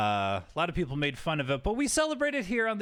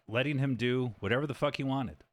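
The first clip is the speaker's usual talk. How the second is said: usual speaking voice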